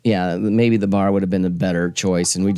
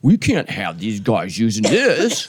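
mocking the company; slower than usual. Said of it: "It's like".

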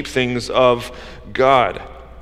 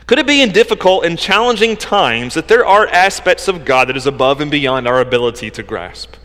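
ordinary delivery

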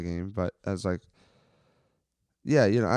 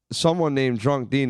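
normal talking voice

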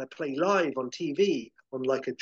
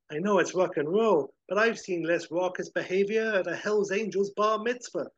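higher put-on duck voice, louder and dry; wry Howard voice; 6 semitones higher, 3 dB louder than usual. Howard, smoking after fleeing the fire, in a dry, higher duck voice. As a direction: let the duck grumble about the wild party. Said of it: "And he says".

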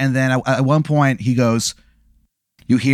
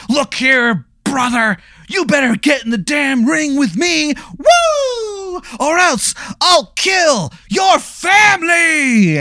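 hammed-up wrestler; 12 semitones higher, slower than usual. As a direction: bellow it like a pro wrestler promo. Wrestler threatens on mic.

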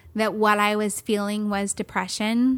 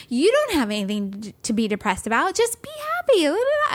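much higher, breezy know-it-all; mock cheery dismissal; 6 semitones higher than usual. She mocks friends who say just be happy, high breezy. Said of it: "they're like".

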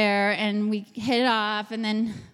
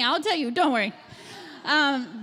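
normal talking voice

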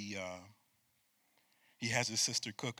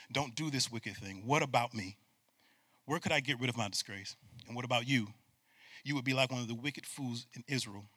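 scripture reading; 2 semitones lower, 5 dB quieter than usual. Acted.

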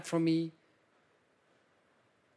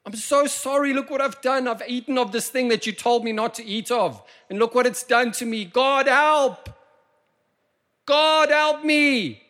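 much higher, louder pleading cry; repentant cry; 13 semitones higher, 9 dB louder than usual. Acted. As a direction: cry out to God like a guilty child. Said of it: "they said".

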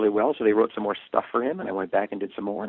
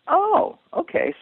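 surprised; 4 dB louder, much slower than usual. Retold or imitated. Reacting to producers' instruction.

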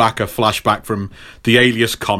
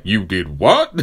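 lower-pitched mock indignation; Luke Cage; 5 semitones lower, slower than usual. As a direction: drop the voice, question incredulously. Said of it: "Luke Cage is like".

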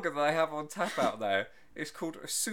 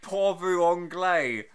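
mock tourist; 7 dB louder, much slower than usual. Mocking tourists abroad.

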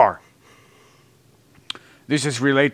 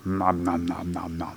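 low soft eating nom noms; 6 semitones lower, 9 dB quieter than usual. Pretend. Mock eating noises.

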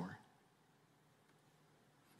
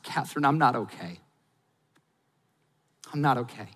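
quiet confession; 3 semitones lower, 5 dB quieter than usual. Retold or imitated. Confessing to wife.

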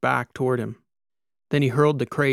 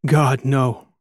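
gasped; 6 dB louder than usual. A narrator's reading. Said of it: "Iberico gasped".

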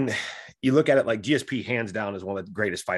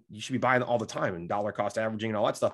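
usual speaking voice

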